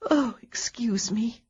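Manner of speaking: slow, yawning whisper; through a yawn. Acted. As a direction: say it mid-yawn, drowsy. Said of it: "to stifle an enormous yawn".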